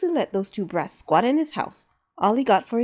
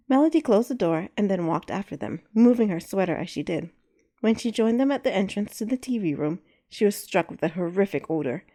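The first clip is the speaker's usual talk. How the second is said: usual speaking voice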